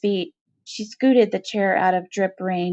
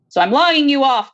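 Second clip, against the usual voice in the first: louder, higher clipped command; stern warden; 5 semitones higher, 5 dB louder than usual. Acted. Warden dismisses the convict, louder and higher. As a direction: bark the dismissal.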